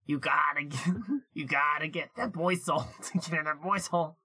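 put-on whispered, slurred drunk voice; drunk impression. Doing a drunk impression while joking. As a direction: mumble it like a drunk. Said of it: "a drunk impression".

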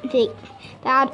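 ordinary talk, nothing marked

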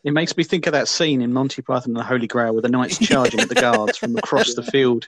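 normal talking voice

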